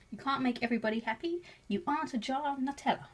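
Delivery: normal talking voice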